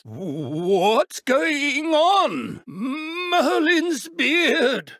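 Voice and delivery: slow, high, drawn-out bewildered cry; dazed exclamation. Acted. Professor confused by a spell, slowed bewildered cry. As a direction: wail it as if the world spins.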